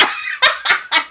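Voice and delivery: very high-pitched laughing fit; helpless laugh. Real, not acted. Recapper cracks up at her own rant, high laugh. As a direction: burst into high giggles.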